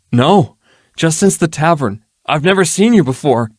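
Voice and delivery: high, nervous voice of a cornered thug; frightened ruffian. Acted. Ruffian at knifepoint answers Erilyn, high and nervous. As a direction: squeak it out with a knife at your jaw.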